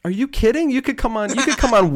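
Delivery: high, amused disbelief; incredulous. Real, not acted. Host scoffs at the guest's self-doubt, amused and incredulous. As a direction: brush off her worry with surprise.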